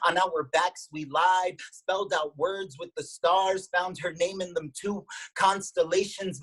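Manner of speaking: lilting spoken-word delivery in rhythmic phrases; incantatory poetry-reading voice; poem recitation. Acted. Reads own poem aloud.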